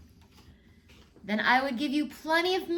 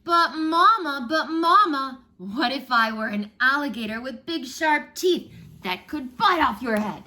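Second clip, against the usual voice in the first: put-on child's voice; 5 semitones higher, 8 dB louder than usual. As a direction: whine it out like an eager child begging mama. Child asking mama again.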